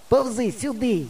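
high, thin call from afar; distant call. A narrator's reading. Hidden friend directs the boy to him, distant call. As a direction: summon someone from far off. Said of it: "тоді гукає".

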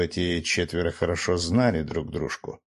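usual speaking voice

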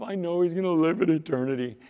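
high-pitched, put-on woman's reply; protesting sister. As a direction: pitch it up as the grieving sister answering back. Sister answers Jesus about Lazarus.

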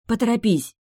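nervous plea to hurry; nervous urging. Acted. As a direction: urge him on, fidgety and cold. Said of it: "попросила я, нервно переступая".

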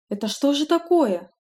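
high, alarmed gasp of a question; shocked exclamation. Acted. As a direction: clap hands in horror. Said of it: "хлопнула в ладоши Элла Юна".

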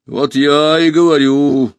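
mildly mournful machine, drawn out; a touch of robotic sorrow; sad robot. A narrator's reading. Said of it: "печально заметил робот".

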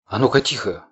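ordinary delivery

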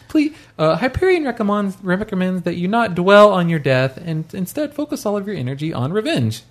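lightly voiced, high AI announcer; a hint of a cheery corporate computer. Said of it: "the computer says hilarious things to you like".

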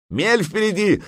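very high-pitched alarm cry; yelled warning. A narrator's reading. Lookout Дрент warns of a shoal, yelling. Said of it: "заорал Дрент".

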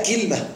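كلمة as kil-ma, two syllables; third dialect form. Shown as a demonstration. Teacher lists three variants of the word, كلمة pronounced kilma. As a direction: say كلمة with i on kāf and vowelless lām, kilma. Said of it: "عندنا ثلاث لغات في كلمة".

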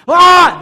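"¡ah!" muy agudo y fuerte; exclamación de sobresalto; grito súbito. Real, not acted. (interrumpe gritando de golpe)

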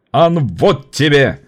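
slow, mocking taunt with a fig. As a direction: draw it out to jeer. (издевательски)

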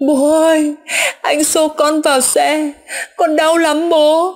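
high, slow, drawn-out wail; sobbing child. A narrator's reading. Son in father's dream saying it hurts, crying wail. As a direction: cry it out like a small boy in pain. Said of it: "thấy nó khóc, mồm mếu máo".

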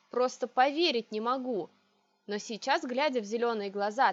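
ordinary delivery, nothing marked

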